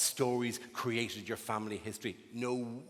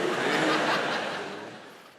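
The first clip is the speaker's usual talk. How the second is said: real laugh; 5 dB louder than usual. Real, not acted. Laughs at his own bit.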